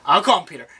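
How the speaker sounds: ordinary talk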